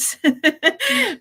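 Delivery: short, high giggly laugh; chuckle. Real, not acted. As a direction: let out a quick high laugh. Host laughs after saying good.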